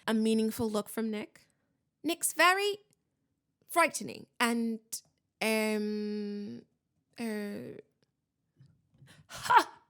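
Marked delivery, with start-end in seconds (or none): 2.0-7.8 faltering improvisation. A narrator's reading. Boy tries to flatter the ghost, faltering. Harry improvising praise for Nick. 9.3-9.8 loud, high scornful bark; one explosive, disbelieving yell. A narrator's reading. Huntsman's head scoffs at the boy, yelling. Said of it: "yelled Sir Patrick's head".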